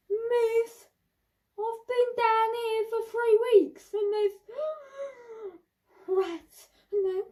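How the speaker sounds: high, hammed-up sobbing plea; mock crying kid. Child in school prison begging the teacher, mock sobbing. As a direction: wail it like a trapped child.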